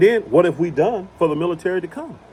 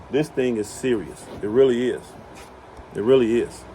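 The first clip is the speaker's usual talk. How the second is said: normal talking voice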